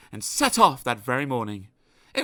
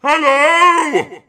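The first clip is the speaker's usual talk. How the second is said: much higher, very loud hello; shouted greeting; 12 dB louder than usual. Acted. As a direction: yell it across the street. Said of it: "They shouted at each other".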